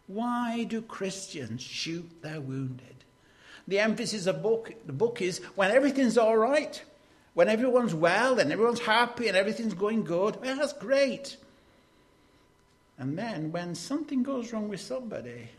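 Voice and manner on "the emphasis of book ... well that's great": fast, high and loud, piling it up; breezy, rushed enthusiasm; upbeat good-times voice. Acted. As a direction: rattle it off cheerily, high and brisk. Mocks cheerful good-times Christians.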